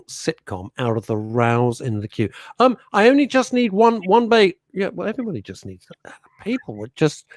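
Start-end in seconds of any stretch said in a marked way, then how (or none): 2.6-4.5 high, loud whiny plea from the queue; pleading customer voice. Retold or imitated. 4.7-7.2 whispered aside. Real, not acted.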